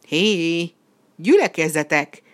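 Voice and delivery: loud commanding call; shouted summons. A narrator's reading. Red devil summoning the missing animals, loud shout. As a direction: call out loudly to a crowd. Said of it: "kiáltotta újból a vörös ördög".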